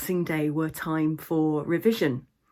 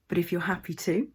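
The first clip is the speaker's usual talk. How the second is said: guided meditation. Acted. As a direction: guide listeners into the imagined scene, unhurried.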